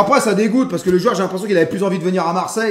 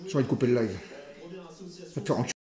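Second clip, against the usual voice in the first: very low, soft grumble; fed up, almost inaudible; sullen mutter; 10 semitones lower, 14 dB quieter, much faster than usual. Real, not acted. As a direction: grumble it under your breath. Muttered threat after scolding chat.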